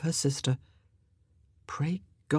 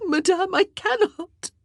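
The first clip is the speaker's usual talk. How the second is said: tearful; 5 dB louder than usual. A narrator's reading. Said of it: "beginning to weep again".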